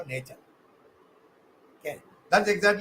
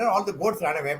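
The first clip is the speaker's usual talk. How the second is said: ordinary delivery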